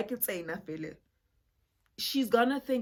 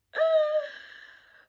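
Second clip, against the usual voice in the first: high mocking laugh; 9 dB louder than usual. Aunt scoffs at ghosting plan.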